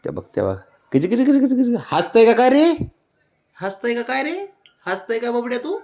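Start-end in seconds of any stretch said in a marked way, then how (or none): 0.9-1.8 high chirpy kich sounds; kissy pet calls. Real, not acted. Owner chirping at her dog to tease it. 1.9-2.8 high, louder teasing question; pet talk. Real, not acted. Owner teasing her dog, high and louder. 3.6-5.8 high but softer cooing question; soft pet talk. Real, not acted. Owner cooing softly at her dog, high.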